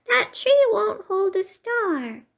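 high little cub's voice; little bear crying out. A narrator's reading. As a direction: pipe up with an objection from afar. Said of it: "cried out".

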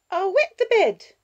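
put-on very high, loud little-girl threat; threatening child. Six-year-old reaching for a threat, threatening child voice. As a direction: threaten as a small child in a tantrum.

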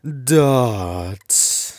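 ordinary talk

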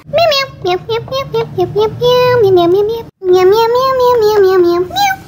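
rapid string of pretend meows; cat meowing. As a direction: chatter meow after meow like a cat. Cat scampers away meowing.

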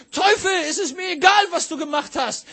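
loud, high, defiant order to the devil; fierce spiritual rebuke shouted; commanding rebuke. Retold or imitated. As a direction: command the devil to give it back. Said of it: "drehte mich um ... und sagte".

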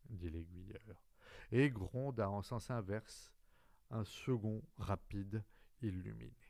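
plain reading voice

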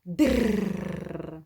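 Др with a trilled р; rolled dr. Shown as a demonstration. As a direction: push air through and roll Др. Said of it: "Ось такий ще є метод". (Др з вібрацією язика)

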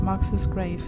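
ordinary talk, nothing marked

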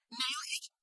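"Nail it?" breathed as a soft, voiceless whisper. Acted. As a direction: drop "Nail it?" to a frightened whisper. Boy asks about nailing coffin.

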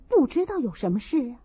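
ordinary delivery, nothing marked

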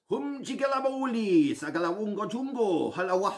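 high, theatrical nonsense spell; magic words. Pretend. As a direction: chant it like a stage magician. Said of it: "say the magic words".

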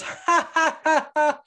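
high mocking "ha ha"; imitated kid's laugh. Kid laughing at man's fallen son, imitated mocking laugh. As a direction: fake a child's high-pitched taunting laugh. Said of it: "the kid starts laughing".

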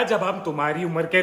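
normal talking voice

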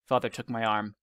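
ordinary delivery

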